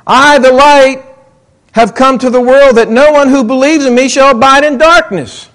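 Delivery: ordinary talk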